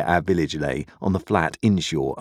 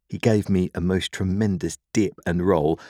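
plain reading voice

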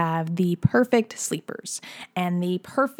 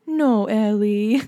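slow, drawn-out mock pout; sad toddler; much slower than usual. Toddler separated from her sister at nap, sad little voice. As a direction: imitate a little one missing someone, dragging the words. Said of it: "She was, like, really sad".

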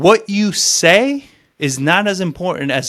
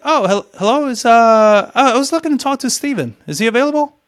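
stammering, much higher, flustered and polite; 8 semitones higher than usual. Shown as a demonstration. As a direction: fumble politely when a woman answers. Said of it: "I'm gonna be like".

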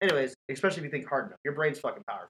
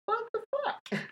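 squeaky high question breaking into laughter; high, amused imitation of shock; mock disbelief; 4 dB quieter, slower than usual. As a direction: act out bafflement in a tiny high voice. Acts out seeing the ghostly girl.

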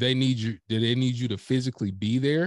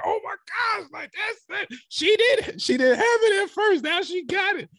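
excited; 4 dB louder than usual. Retold or imitated. Retelling excited reaction to award.

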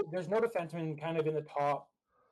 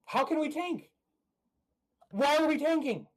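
high, raised-voice exasperation; yelled protest; 9 semitones higher, 3 dB louder than usual. Real, not acted. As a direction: yell it in real disbelief, pitched way up. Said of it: "you just went staticky there when you yelled".

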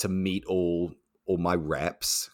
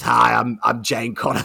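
imitating a rep's gravelly introduction; 3 semitones higher, 5 dB louder than usual. Bar manager imitating a rep introducing herself, lightly gravelly. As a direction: introduce yourself with a touch of gravel. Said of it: "very unmistakable, gravelly voice".

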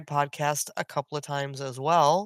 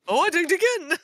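much higher, louder excited gamer voice; Bricko impression; 12 semitones higher, 4 dB louder than usual. Mimicking a friend's leveling up, excited and high. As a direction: squeal it like a delighted new player. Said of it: "your Bricko impersonation".

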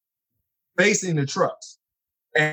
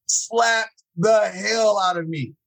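slow, higher, every word leaned on; slow emphatic retelling; 5 semitones higher, slower than usual. Retold or imitated. Retelling the church slap to listeners, slow and emphatic. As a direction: drag it out to make the slap land.

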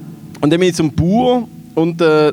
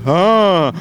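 long wordless "ah" for an answer; drawn-out mock farmer grunt; imitated grunt. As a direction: answer with nothing but a noise. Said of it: "er hat einfach Geräusche gemacht".

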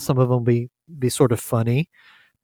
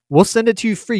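excited; 9 semitones higher, 5 dB louder, faster than usual. Acted. Playful promise of free shirts.

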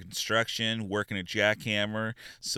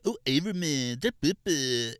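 higher, louder silly goofball voice; hammed-up goofy patter, higher; Averman's schtick; 6 semitones higher, 3 dB louder than usual. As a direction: play the class clown bit. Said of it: "talking like".

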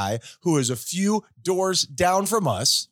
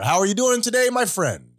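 normal talking voice

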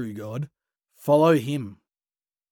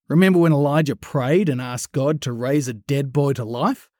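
normal talking voice

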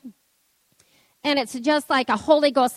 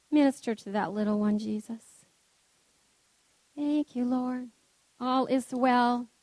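much softer, hushed intercession; quiet prayer; 6 dB quieter than usual. Acted. Pastor praying softly over a little one, hushed prayer. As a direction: breathe it out as a gentle prayer.

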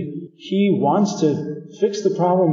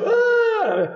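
صرخة. Retold or imitated. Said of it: "she would just go".

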